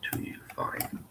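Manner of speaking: breathy whispered line; barely a breath, whispered; whispering. Acted. Whispered aside in the scene.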